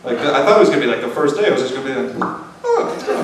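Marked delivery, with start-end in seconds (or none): 2.7-3.2 very high, small voice; mock surprise. Patient imagining his hair gone on day one, high mock surprise. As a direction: squeak out the discovery.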